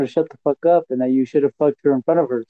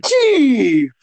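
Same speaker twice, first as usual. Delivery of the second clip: shocked outcry; 8 semitones higher, 4 dB louder than usual. Real, not acted. Friend shocked at topless news.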